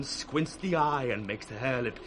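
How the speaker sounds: ordinary delivery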